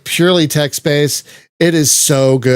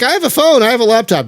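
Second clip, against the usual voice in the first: mock objecting listener; 9 semitones higher, faster than usual. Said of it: "You might be like".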